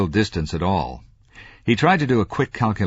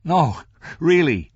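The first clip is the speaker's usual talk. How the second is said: high, loud, slow polite refusal; declining; 11 semitones higher, 5 dB louder, slower than usual. A narrator's reading. Franklin declines the whiskey, protesting. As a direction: protest in a raised, drawn-out voice. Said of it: "Franklin began to decline".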